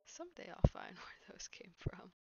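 whispered through a giggle; laughing whisper. Real, not acted. Late-night gamer to viewers, giggling whisper. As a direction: chuckle while whispering the promise.

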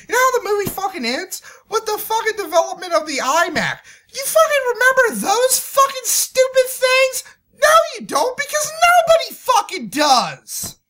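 high, fast, loud scornful shouting; real furious ranting; angry rant. Real, not acted. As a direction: rail at the audience. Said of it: "but I'm so fucking angry".

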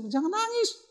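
imitated wife, squeaked very high; high-pitched mock scolding. Wife telling crying husband to stop, high mock scolding.

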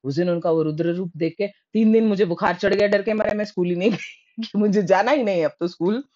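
ordinary talk

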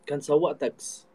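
ordinary talk

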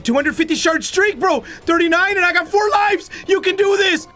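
high-pitched hyped-up urging; excited self-cheer. Real, not acted. Player on a long streak, excited self-cheer.

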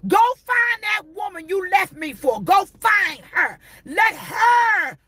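preach-shout, hammering each word. Acted. Preacher voicing the left partner to the cheater, shouting.

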